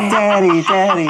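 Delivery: loud, high cry of Daddy; full volume. Acted. Kid yelling Daddy at full volume. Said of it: "but then at full volume".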